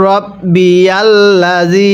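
slow, stretched recitation with long vowels; drawn-out Quran recitation. Shown as a demonstration. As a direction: chant it slowly, holding the long vowel. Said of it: "কয় আলিফ টান দিতে হবে".

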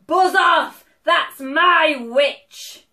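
loud, drawn-out monster bellow; terrible beast voice. A narrator's reading. Beast warning dragon off the witch, loud bellow. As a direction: bellow slowly like a horrible beast. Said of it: "its terrible voice".

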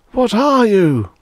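high, loud scream. A narrator's reading. Lawyer strapped before his captor, screaming. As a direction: scream it in panic, falling at the end. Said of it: "screamed Mister Bradbury".

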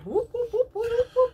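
excited whooping. Real, not acted. Cheering the winner.

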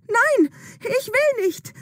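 high, slow panicked refusal; girl refusing the witch's drink, screaming in despair; desperate scream. Acted. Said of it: "Sie schreit ihre ganze Verzweiflung heraus".

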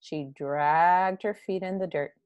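ordinary delivery

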